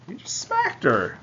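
high, indignant cry of disbelief; shocked outburst. Real, not acted. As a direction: blurt it out, appalled at the slap. Reader reacts to captain's slap.

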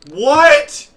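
sudden shocked yell; startled shout; surprised shout. Real, not acted.

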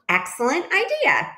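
high-pitched delighted approval; exclaiming. A narrator's reading. Duck exclaims at Goose's idea, mildly excited. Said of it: "exclaimed Duck".

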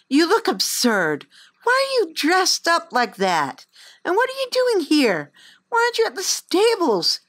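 high, rising string of scolding questions; cross girl. Acted. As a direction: pile on the questions, cross and bossy.

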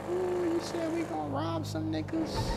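high squeal of mock shock; ooh, jump way up in pitch in disbelief; hammed-up surprised squeal. Shocked reaction to robbery plan.

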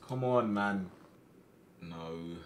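usual speaking voice

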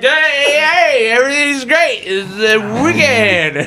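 put-on loud, high drunken cheer; drunk guy. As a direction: imitate a drunk insisting all is fine, stretching the end. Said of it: "getting out of the car drunk".

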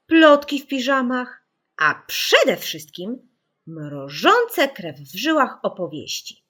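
plain reading voice

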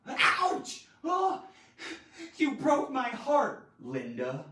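loud, high yelp into heartbroken wail; emotional drama. Shown as a demonstration. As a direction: melodramatic wounded outcry, dropping on the name. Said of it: "Add the emotion and dramas to it".

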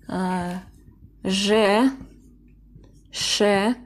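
usual speaking voice

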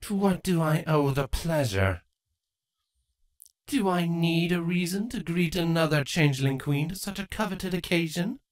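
lightly voiced haughty queen; a hint of mock politeness; queen's voice.

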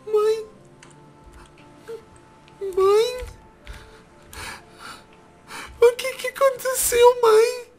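high, small stricken voice; high-pitched fragile distress; shocked grief. Acted. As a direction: plead in stunned disbelief. Finds mother lifeless.

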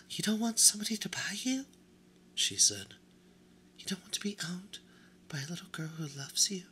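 high, fast, breathless disbelief; shocked doll whisper. Acted. The doll gasps that Edward doesn't want to be owned, high and fast. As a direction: she can't believe her ears.

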